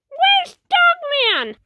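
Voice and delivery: put-on high, slow, eager kid question. Little kid asking papa for Dogman, high and eager. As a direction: ask it like an excited little kid.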